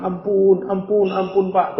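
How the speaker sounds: high pleading cry for mercy; pleading child. Retold or imitated. Beaten child begging for mercy, high pleading voice. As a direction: beg the teacher to stop hitting. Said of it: "tuh dibilang".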